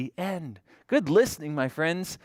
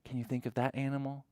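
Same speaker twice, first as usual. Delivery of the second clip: normal talking voice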